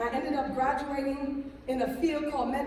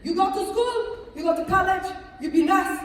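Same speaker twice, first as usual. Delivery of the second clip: loud, high, exasperated father impression; imitated stern father; 8 semitones higher, 9 dB louder than usual. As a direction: lay down the law as an exasperated father. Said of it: "My father said".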